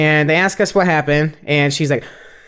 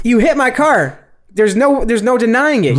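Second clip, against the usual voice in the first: retold yell; 6 semitones higher, slower than usual. Retold shouting match with lady.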